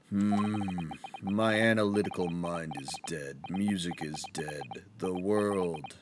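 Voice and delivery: low, slow, gloomy mutter; depressed Sholmes. Acted. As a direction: sigh it out like nothing matters. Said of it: "he's depressed".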